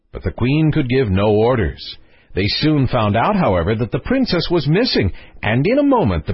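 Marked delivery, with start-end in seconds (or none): none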